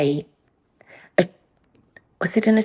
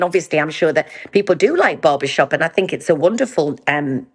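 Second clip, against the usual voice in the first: usual speaking voice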